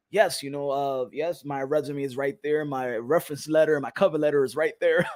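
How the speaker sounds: low, hushed formal delivery; polite interviewee act. Acted. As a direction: softly play the proper job candidate. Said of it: "I ain't talking like this".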